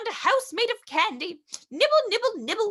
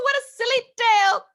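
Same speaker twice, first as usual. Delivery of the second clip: usual speaking voice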